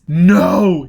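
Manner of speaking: high, loud refusal; denial. A narrator's reading. Foyle refusing the truth about his face, loud. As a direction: reject it outright.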